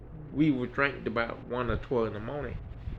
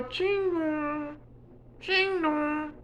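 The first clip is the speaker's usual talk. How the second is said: doorbell chime imitation; 12 semitones higher, 5 dB quieter, much slower than usual. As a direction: sing the chime high and slow, ding-dong style.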